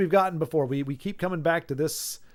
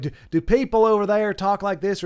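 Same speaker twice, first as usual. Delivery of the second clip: Southern accent; 5 semitones higher, 7 dB louder than usual. Shown as a demonstration. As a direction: put on a loud Southern twang. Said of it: "Southern accent".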